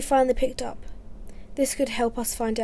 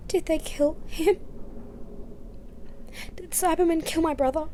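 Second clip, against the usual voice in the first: stunned grief; 5 semitones higher than usual. Acted. Fearing brother dead.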